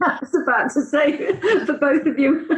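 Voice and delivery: high, laughing teasing; laughing tease. Real, not acted. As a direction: tease her through laughter.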